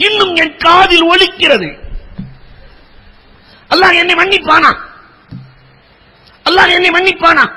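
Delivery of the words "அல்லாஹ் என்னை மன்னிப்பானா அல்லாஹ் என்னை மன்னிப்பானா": high, loud pleading cry; anguished cry. Retold or imitated. Grieving father begging the Prophet, anguished high cry. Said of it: "என்று அலறுகிறார்".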